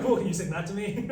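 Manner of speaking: asking through a laugh; laughing question. Retold or imitated. Quoting a friend, laughing.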